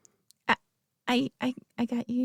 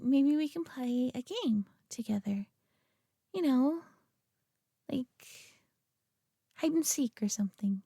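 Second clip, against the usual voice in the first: teasing whisper; 6 dB quieter than usual. Acted. Teasing playful suggestion.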